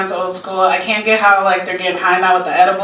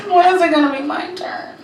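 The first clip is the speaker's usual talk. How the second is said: put-on disappointed teenage girl; 6 semitones higher than usual. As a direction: whine it like a let-down teenager. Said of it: "just disappointment".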